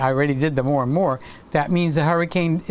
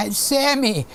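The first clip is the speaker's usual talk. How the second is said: put-on higher, slow doddering old-man voice; hammed-up confused old man hunting for his cane; mock old man; 8 semitones higher than usual. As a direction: play yourself at seventy, lost and calling out. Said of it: "I'll be".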